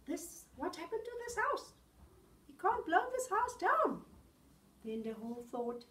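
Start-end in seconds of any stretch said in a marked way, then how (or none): 0.1-4.1 surprised. Acted. Wolf surprised by brick house.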